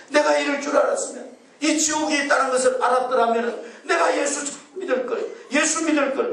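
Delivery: high, mock wailing lament of a lost soul; wailing regret. Pastor voicing a damned soul weeping in hell, high mock wail. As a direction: cry out the regret, pitched high. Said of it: "막 그러면서 막 우는 거예요".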